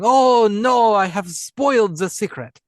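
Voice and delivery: high, loud hammed-up German accent as Albert; German-accented overacted dismay; imitating Broadbent's silly German accent; mock German accent. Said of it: "that went a little French".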